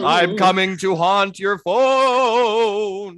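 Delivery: slow, high ghostly wail; haunt them slowly like a ghost. Acted. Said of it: "sounded like a ghost".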